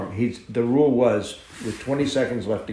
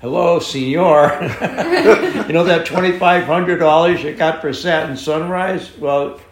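loud, higher-pitched crowing retelling; gleeful confrontation; 7 semitones higher, 9 dB louder than usual. Retold or imitated. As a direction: act out rubbing it in, big and loud. Gleeful confrontation over bargain mare.

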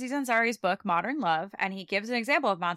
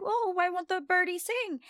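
put-on childlike Drusilla; 8 semitones higher than usual. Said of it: "this moment where she's like".

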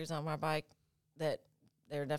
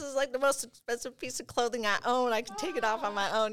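high, louder mock outrage; indignant protest; 8 semitones higher, 4 dB louder than usual. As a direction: plead indignantly to save a prized piece of clothing. Protesting to paramedics cutting favorite clothing.

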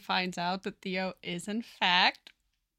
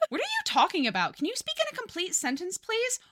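fast, rising higher to a squeaky please; exasperated; 9 semitones higher, faster than usual. Acted. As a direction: lose patience, pitch climbing. Sheldon exasperated with mumbling Theo.

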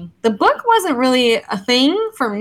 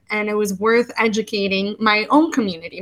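ordinary delivery

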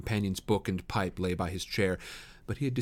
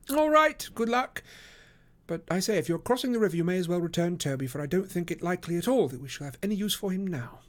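Holmes, brisk send-off; 9 semitones higher than usual. Acted. Detective sends friend off with errand, raised pitch. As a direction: call out high, then settle into instructions. Voices Holmes sending Watson off.

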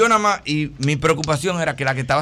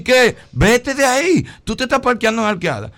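higher, louder alarmed voice; mock alarmed caller; 8 semitones higher, 6 dB louder than usual. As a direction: sound alarmed on the phone. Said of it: "Y me dice". (llamada alarmada, más aguda y fuerte)

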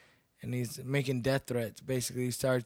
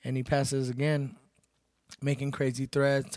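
normal talking voice

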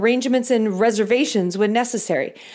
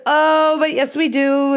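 startled insistence; 6 semitones higher, 4 dB louder, slower than usual. Retold or imitated. Insisting to hostess.

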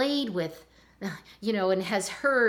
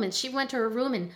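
ordinary delivery

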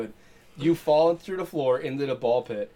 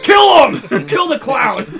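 high, full-volume yell; mock mob shout; 12 semitones higher, 14 dB louder, much slower than usual. Mob finds a teenager dressed as clown, mock bloodthirsty yell. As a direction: rouse a lynch mob, hammed-up.

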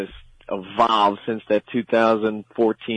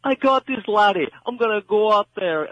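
mock Irish coach; 10 semitones higher than usual. Pumping up his fighter in a hammed-up Irish brogue. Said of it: "His coach is gonna look him in the eyes and say".

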